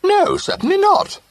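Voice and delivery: very high, loud, slow refusal; emphatic refusal. A narrator's reading. Companion refusing Jim's proposal, emphatic. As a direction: hammer the no down hard. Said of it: "with quite unnecessary emphasis".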